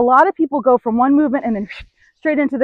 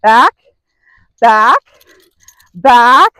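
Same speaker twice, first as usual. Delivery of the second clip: firm, louder repeated back; firm cue; 7 dB louder, much slower than usual. Shown as a demonstration. Trainer to horse, a firm louder back cue. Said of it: "I'm gonna say".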